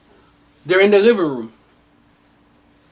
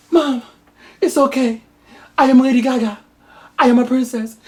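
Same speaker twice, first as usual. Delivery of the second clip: daughter's voice; 6 semitones higher than usual. Acted. Daughter replies in movie parody.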